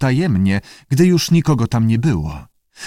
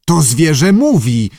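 raised, louder outburst; indignant director; indignant exclamation; 5 semitones higher, 5 dB louder than usual. A narrator's reading. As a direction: exclaim it in outrage. Director outraged at talking animal.

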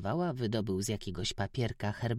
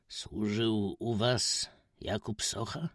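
stranger asking; slower than usual. A narrator's reading. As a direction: ask carefully, hoping. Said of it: "zagadnął nieznajomy".

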